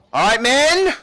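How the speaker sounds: loud, high barking officer's call; rally the troops like a half-elf captain; commanding captain. Acted. Captain calling her men to attention, loud and commanding.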